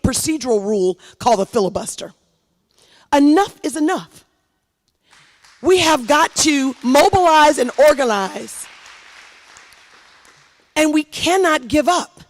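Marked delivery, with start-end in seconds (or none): 3.1-12.2 rallying cry. Real, not acted. Congresswoman rousing the Selma crowd to mobilize, loud rallying cry. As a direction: rouse the crowd to keep fighting.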